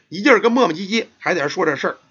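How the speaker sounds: ordinary delivery, nothing marked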